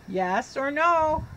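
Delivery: ordinary delivery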